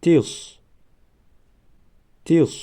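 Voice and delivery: تیس read high and clear; syllable demo. Shown as a demonstration. Teacher reading تیس high for pupils. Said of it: "دیگرش".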